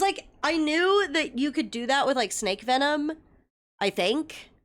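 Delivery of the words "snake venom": usual speaking voice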